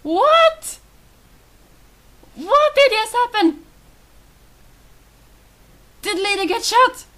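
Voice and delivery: high, loud disbelief; shocked outcry. Real, not acted. Player sees a character shot, shocked high outcry. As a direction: cry out in alarm, pitch leaping up.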